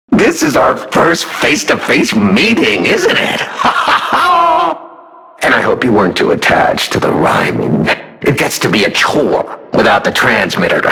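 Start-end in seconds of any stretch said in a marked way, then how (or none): 3.4-4.8 shrieking villain laugh; delighted maniac cackle. Acted. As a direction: burst into a wild cackle. Villain greets the hero.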